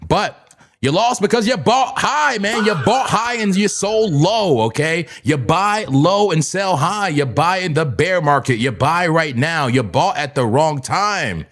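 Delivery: preachy lecture. Pretend. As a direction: hammer the lesson home.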